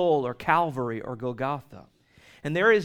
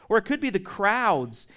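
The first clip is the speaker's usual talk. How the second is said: normal talking voice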